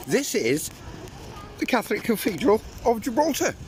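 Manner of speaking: high pompous tour-guide voice; grand announcer. Pretend.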